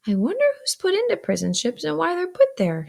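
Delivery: put-on high-pitched wondering child; quiet desperation. A narrator's reading. Pip wonders aloud about prison ships, quietly desperate. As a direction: wonder aloud to nobody in particular. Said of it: "in a general way and with quiet desperation".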